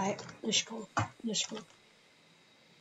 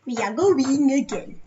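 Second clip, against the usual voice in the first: duck voice; 3 semitones higher, 11 dB louder, slower than usual. Pretend. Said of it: "that's my duck voice".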